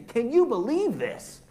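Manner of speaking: high, loud, amazed disbelief; awestruck, emphatic question; wonder and awe. Acted. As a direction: exclaim it in astonishment, pitch leaping up. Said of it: "a sense of wonder and awe".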